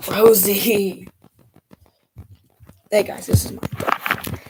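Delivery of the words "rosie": loud, drawn-out call of the dog's name; scolding a dog. Real, not acted. Scolding the dog mid-stream.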